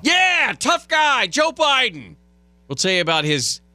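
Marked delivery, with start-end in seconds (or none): 0.0-2.1 mocking. Real, not acted. Mocking the tough-guy story.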